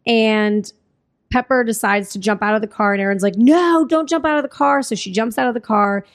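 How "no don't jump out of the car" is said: higher-pitched retold cry of alarm; mock-urgent warning, pitch jumping up. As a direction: imitate a panicked plea to stop. Character pleads with fleeing friend.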